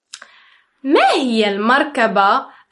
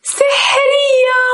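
high, excited call of the word; excited cry; 8 semitones higher than usual. Shown as a demonstration. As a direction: cry it out with delight. Teacher cries out magic word.

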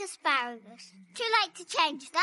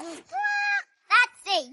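ordinary talk, nothing marked

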